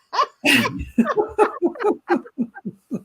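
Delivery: a high, hearty burst of laughter; big laugh. Real, not acted. Medium laughing at her joke about Tony's belly, high hearty laugh.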